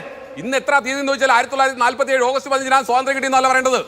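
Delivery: high-pitched, exasperated argument; heated rebuke. Real, not acted. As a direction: argue over the interruptions without letting go. Rebuking evasive opponent amid crosstalk.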